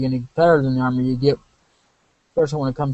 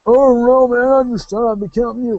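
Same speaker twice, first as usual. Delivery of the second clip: much higher and louder, a goofy dude voicing the sidekick; put-on sidekick voice; 9 semitones higher, 5 dB louder than usual. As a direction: play the laid-back sidekick, pitched way up. Voicing a game sidekick character.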